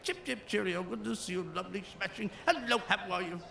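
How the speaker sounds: soft mock English accent, chirpy and light. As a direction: lightly parody cheerful English pleasantries in an English accent. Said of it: "So I do everybody from England".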